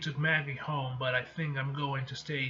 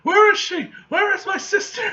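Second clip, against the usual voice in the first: much higher and louder, urgent yelling; frantic demand; 13 dB louder than usual. Acted. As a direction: burst in shouting for the sister. Brother storms in demanding sister.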